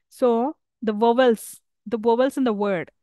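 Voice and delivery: ordinary talk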